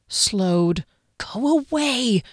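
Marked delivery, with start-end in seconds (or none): 1.2-2.2 fearful plea. A narrator's reading.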